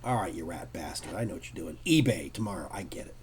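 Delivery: low, soft grumble under the breath; muttered aside. Retold or imitated. Artist grumbles to himself about the scalper, low mutter.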